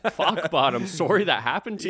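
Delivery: hammed-up friendly Canadian sympathy, laughing through it; nice Canadian accent. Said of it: "they're nice".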